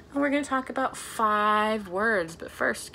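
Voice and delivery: ordinary talk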